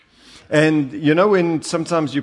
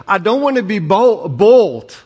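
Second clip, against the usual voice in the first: loud high protest; 7 semitones higher, 7 dB louder than usual. Retold or imitated. Man protesting in retold story.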